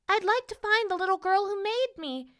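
high, small, wishing voice; paper princess. A narrator's reading. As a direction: make a wish as a little doll.

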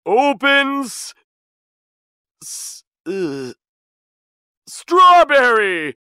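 loud start, halting, then shouted wrong word; stammering, desperate magic shout; faltering cry. A narrator's reading. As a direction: fumble for the password, then blurt it high. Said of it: "he cried".

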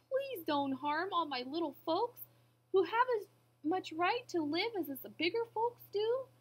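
high-pitched earnest plea; begging. A narrator's reading. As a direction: plead for your little friends. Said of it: "and begged".

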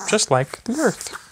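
ordinary delivery

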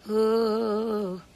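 ordinary delivery

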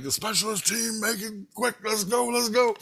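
high-pitched urgent rallying imitation; urgent team leader. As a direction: act out someone hurrying the team along. Acts out man rallying specialist team.

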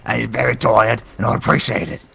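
put-on defending Mister Bones in a cheeky British accent; hammed-up British accent. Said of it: "through your change of accent".

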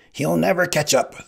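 high, loud cry of the Sicilian. A narrator's reading. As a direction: call it out high and forceful. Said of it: "the Sicilian cried".

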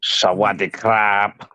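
slow Thai-twanged greeting. Shown as a demonstration. Friend showing off the Thai twang he's got, slow Thai accent. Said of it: "the Thai twang he's got".

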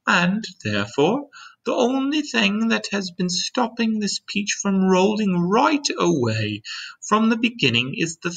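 high-pitched, gentle lady's voice; kind ladybug voice. A narrator's reading. Ladybug standing beside James to explain, kind high voice. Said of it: "the ladybug went on".

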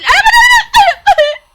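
startled gamer screaming at a jump scare; terrified scream. Real, not acted. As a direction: shriek it in real panic. Jump scare in horror game.

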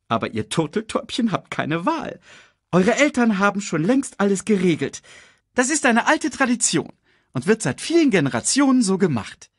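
high, lively lecturing character voice; insistent Sasu. Acted. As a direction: lay down the rule in a high-pitched voice. Sasu lays down the tradition.

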